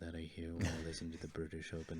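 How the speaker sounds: soft British golf-broadcast murmur; low-volume British announcer tone; hushed British commentator. Pretend. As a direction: drop to a quiet British Open commentator voice. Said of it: "British Open in the morning".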